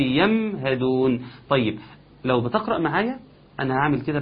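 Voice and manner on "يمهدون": يمهدون slowly with the sakin mim clearly held. Shown as a demonstration. Teacher isolating the second mim. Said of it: "الميم الثانية".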